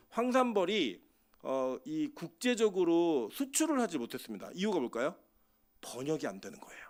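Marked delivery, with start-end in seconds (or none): 5.8-6.8 hushed whispered punchline; a secret-like whisper to the congregation. Real, not acted. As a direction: drop to a whisper to land the point.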